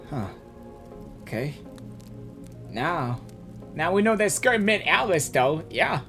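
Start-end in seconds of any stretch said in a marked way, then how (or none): none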